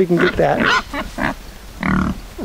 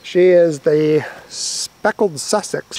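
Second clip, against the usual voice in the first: normal talking voice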